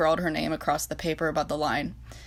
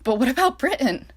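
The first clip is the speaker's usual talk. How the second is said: higher, louder cry with a breaking voice; 7 semitones higher, 5 dB louder than usual. Acted. France pleading with Reich over Britain, voice breaking. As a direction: let the voice crack on it. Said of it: "she cried, her voice breaking".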